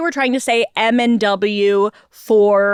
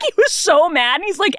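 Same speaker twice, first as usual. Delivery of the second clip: ordinary talk, nothing marked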